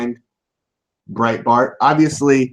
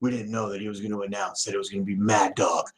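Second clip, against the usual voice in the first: low mock aide voice; 7 semitones lower, 10 dB quieter, faster than usual. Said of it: "his people are like".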